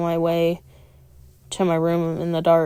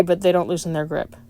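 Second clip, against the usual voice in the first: ordinary talk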